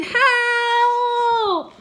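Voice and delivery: high loud cry for help; shout. A narrator's reading. Miguel shouting for help, high and loud. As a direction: yell it out in panic. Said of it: "Miguel shouted".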